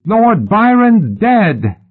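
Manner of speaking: high, slow, drawn-out yell; shrill boy crying out news; boy's yell. A narrator's reading. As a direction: shout the news down from above. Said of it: "the boy yelled".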